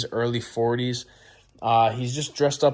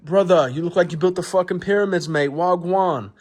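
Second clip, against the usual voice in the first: high-pitched mock Jamaican accent; bad Jamaican accent; 5 semitones higher than usual. New acquaintance ribbing the Egyptian buddy, in bad Jamaican accent. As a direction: exaggerate a badly faked Jamaican accent. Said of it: "my Jamaican accent is bad".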